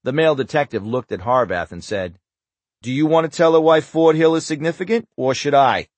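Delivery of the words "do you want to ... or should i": put-on Boston accent. A narrator's reading. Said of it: "his heavy Boston accent".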